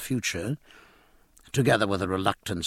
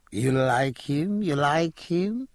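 slow, rising flirtatious ask; coquettish coaxing; 4 semitones higher, slower than usual. Acted. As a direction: tease him slowly, pitch climbing.

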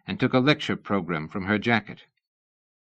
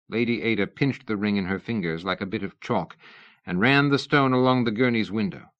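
ordinary delivery

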